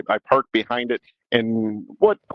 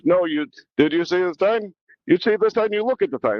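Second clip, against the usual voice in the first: put-on old man's European accent; 7 semitones higher than usual. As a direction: play the fussy old man scolding in his accent. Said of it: "a little European accent".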